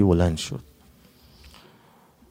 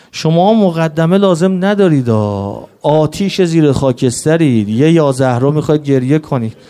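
higher, louder preaching to stir the mourners; impassioned preaching; 5 semitones higher, 5 dB louder than usual. Acted. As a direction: rouse the crowd toward tears. (منبری پرشور و بلند)

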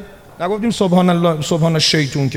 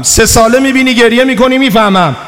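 impassioned plea; 5 semitones higher, 5 dB louder than usual. Retold or imitated. Pleading question to the imam.

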